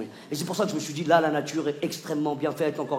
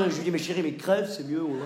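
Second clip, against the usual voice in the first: ordinary talk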